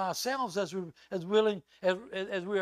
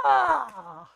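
put-on high loud girlish shriek of joy; two friends squealing on meeting; excited squeal; 11 semitones higher, 10 dB louder than usual. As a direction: squeal with delight. Both girls squeal.

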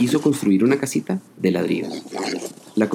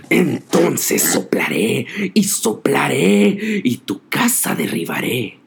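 angry wolf; 3 semitones higher, slower than usual. A narrator's reading. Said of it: "se enfureció y dijo".

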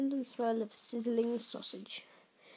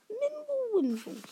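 very high, squeaky mumble; frightened mumble; 13 semitones higher than usual. A narrator's reading. Uncle Vernon gone pale before Hagrid, squeaking. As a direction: squeak out nonsense in fear. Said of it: "whispered something that sounded like".

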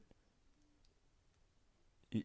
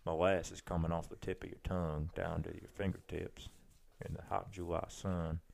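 put-on Georgia accent; 5 semitones lower, 9 dB quieter than usual. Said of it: "Georgia's real easy".

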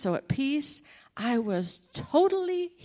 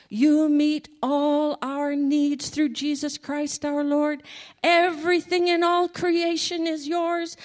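loud, rising praise prayer; fervent prayer; 5 semitones higher, 5 dB louder than usual. Retold or imitated. Prayer warrior praising God on the phone, rising fervent prayer. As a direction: build the praise like a preacher.

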